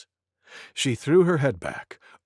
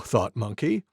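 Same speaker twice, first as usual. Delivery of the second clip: plain reading voice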